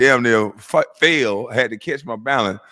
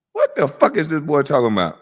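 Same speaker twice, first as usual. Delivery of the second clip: usual speaking voice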